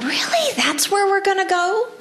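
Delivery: incredulous. Retold or imitated. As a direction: sound stunned at your own mind. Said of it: "And I thought".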